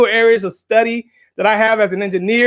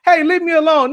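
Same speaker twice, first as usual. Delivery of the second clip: put-on higher, louder child's complaint; annoyed child; 6 semitones higher, 3 dB louder than usual. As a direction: push him off with a whiny hey. Acting out kids' quarrel.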